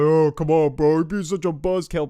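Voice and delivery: loud, whiny mock complaint from the crowd; annoyed groaning partiers. Partygoers downstairs groaning at the buzzkill babysitter, loud whiny complaint. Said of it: "She heard groans of annoyance".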